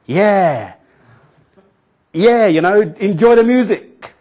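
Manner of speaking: loud, high, slow and awkward; clumsy loud party announcement; awkward mock announcer. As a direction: blurt it out like a nervous MC. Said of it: "I said something really kind of stupid".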